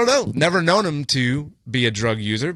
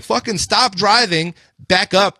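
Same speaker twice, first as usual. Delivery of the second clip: retold yelling; 8 semitones higher, 3 dB louder, slower than usual.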